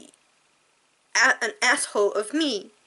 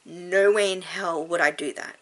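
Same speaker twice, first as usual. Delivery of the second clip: ordinary talk, nothing marked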